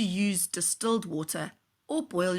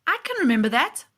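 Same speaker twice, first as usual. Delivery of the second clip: louder, fast, eager reply; eager boy; 5 semitones higher, 6 dB louder, faster than usual. Acted. Tristan eager to learn, quick and louder. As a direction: rush it out keen to learn. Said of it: "said Tristan".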